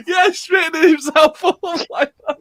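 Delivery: high laughing shout. Real, not acted. Laughing describing the video.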